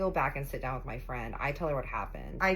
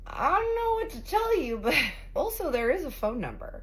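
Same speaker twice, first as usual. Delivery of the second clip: incredulous; 10 semitones higher, 3 dB louder than usual. Retold or imitated. Mocking the lying man.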